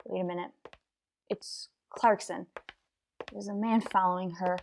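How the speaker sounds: barely above a breath, eyes on the street; hushed. Acted. Detective in hiding reports the decoy and her follower, hushed. Stakeout, watching the suspect pass.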